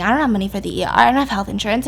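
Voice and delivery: ordinary talk, nothing marked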